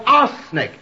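high, loud hammed-up shout; mock bawl. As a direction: bawl it out as the doctor did. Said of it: "and bawled".

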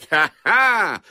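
loud, high villain's laugh in words; sneering. A narrator's reading. Man to his captive girl, sneering. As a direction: gloat like a stage villain. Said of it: "sneered the man".

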